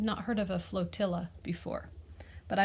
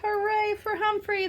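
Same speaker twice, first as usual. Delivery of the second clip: high, loud hooray; crowd shout; 13 semitones higher, 5 dB louder than usual. Acted. Crowd on the riverbank cheering the whale, loud shout. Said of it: "they shouted".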